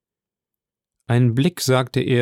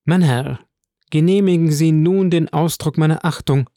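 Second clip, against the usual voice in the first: higher-pitched formal lady; a raised, courteous voice for a formal leave-taking; Madame de Villefort, formally polite; 5 semitones higher, 4 dB louder than usual. Acted. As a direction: address him with ceremonious respect.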